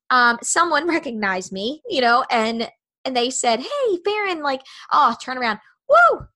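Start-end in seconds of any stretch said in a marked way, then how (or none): 3.6-4.4 high call across a room, name sung out. Retold or imitated. Someone calls her name across room. 5.9-6.3 loud, high whoop of relief; cheering woo. Retold or imitated. Cheering at being recognized.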